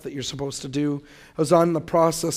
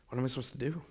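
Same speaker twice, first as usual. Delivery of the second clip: inner thought; 3 semitones lower, 11 dB quieter, much faster than usual. Retold or imitated. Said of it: "I'm thinking in my head".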